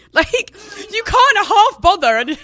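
high, loud, drawn-out laughing protest; laughing disbelief. Real, not acted. Telling co-host about the bare mattress, laughing in disbelief. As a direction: exclaim it slowly through rising laughter.

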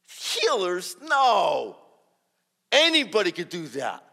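loud, high scoffing correction; emphatic dismissal. Retold or imitated. Friend corrects newcomer about healers, emphatically dismissive. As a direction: brush the idea off loudly.

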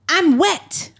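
put-on high, little-boy call; a lightly voiced toddler calling mom; imitating a small child. Toddler telling mom he wet the bed, in a high small voice. Son calls from crib.

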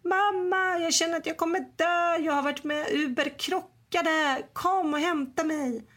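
put-on high, tearful teen voice begging mom; crying son on the phone. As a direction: son calling mom in panic after a crash, high and tearful. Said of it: "så säger han bara".

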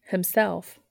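usual speaking voice

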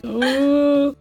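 high whimpered moan; mock crying.

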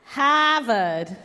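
Boston "Harvard" with dropped r, hammed up; Boston accent. Shown as a demonstration. Asked by her friend, a hammed-up Boston accent. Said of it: "I wanna hear you".

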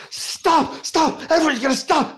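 high, frantic director pleading; Dan. Retold or imitated. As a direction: play the panicking director. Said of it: "I do a very good Dan".

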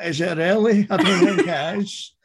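put-on high, loud, laughing Scottish accent; mock Scottish accent. Joking about being told they sound Scottish, hammed-up Scottish accent. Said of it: "You're Scottish".

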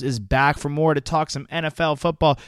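hyped announcer, rising energy; grand entrance announcement. Acted. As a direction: announce the guest like a star. Hyped intro of guest.

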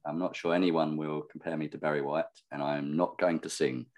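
ordinary talk

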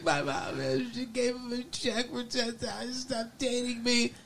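small hushed weepy voice; mock whimpering. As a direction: whimper it out pitifully. Voicing crying Donna.

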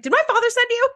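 high mock Guinan voice; high playful imitation; Guinan's question. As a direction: lift the pitch way up for Guinan. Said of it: "she's like".

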